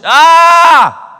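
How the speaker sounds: high, loud stung cry of "ah"; pained outcry. Real, not acted. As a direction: cry out, hit by the verse. Reacting to "filthy language.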